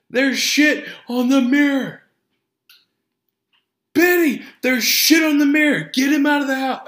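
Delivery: high, loud, hysterical comedy-movie wail; over-the-top freak-out, way up in pitch; Dumb and Dumberer. Retold or imitated. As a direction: shriek it in horrified mock panic. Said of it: "that is from the second Dumb and Dumber".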